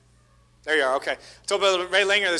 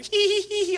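high tittering giggle; a hidden child giggling, high-pitched; stifled giggle; 14 semitones higher than usual. Retold or imitated. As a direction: giggle high and giving yourself away.

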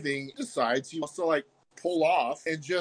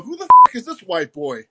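"Who the f-" yelled much higher and louder, then dropping off; mock hostile yell; 4 semitones higher, 14 dB louder than usual. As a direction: bark it out like a suspicious local. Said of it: "And they go".

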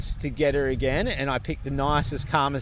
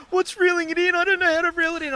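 high, fast mock panic; imitating a flustered, high-pitched complaint; panicked; 13 semitones higher, 3 dB louder than usual. Panicking wife on the jet ski at the reef, mock panicked.

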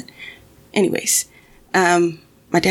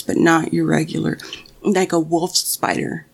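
ordinary talk

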